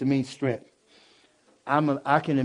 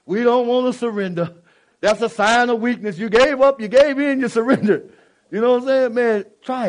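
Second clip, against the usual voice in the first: rising, louder preaching cadence; 7 semitones higher, 6 dB louder than usual. Real, not acted. Preacher pressing men about surrender, preaching loudly. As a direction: build the sermon up with force.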